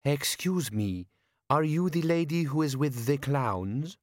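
slow, polite stranger; lightly voiced girl, asking courteously; pleasant young woman. Young woman approaching Nobby, slow polite inquiry.

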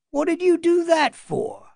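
high, puzzled guardsman; a rough soldier asking, puzzled. A narrator's reading. Guardsman questions Dunk.

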